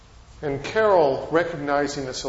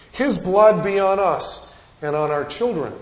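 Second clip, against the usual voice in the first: louder, higher proclaiming of the crowd's words; 5 semitones higher, 6 dB louder than usual. Retold or imitated. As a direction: proclaim it like a whole congregation. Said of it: "the whole congregation proclaims".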